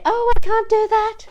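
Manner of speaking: high, loud, put-on voice of a doubting student; hammed-up squeaky "I can't" whine; mock whiny doubter. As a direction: mimic someone giving up before they start. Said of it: "I know a lot of people say".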